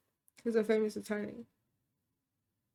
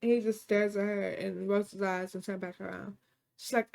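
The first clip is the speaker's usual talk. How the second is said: plain reading voice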